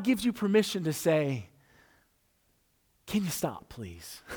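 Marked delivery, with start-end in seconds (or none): 3.1-4.2 hushed plea. Retold or imitated. Plea to God in pain.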